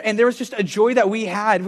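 ordinary talk

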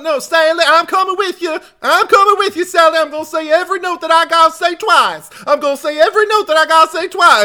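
loud, very high-pitched Southern whine; Franklin, thick Southern accent. Acted. Imitating Franklin pestering Sally in the van, loud high Southern accent. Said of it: "like, a thick Southern accent".

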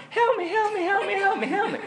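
imitating a buddy's high, frantic cries for help; mock-terrified squeal, very high and repeated; panicked screaming. Retelling a friend's freak-out on a sailing trip, panicked screaming. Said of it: "He was, like, screaming".

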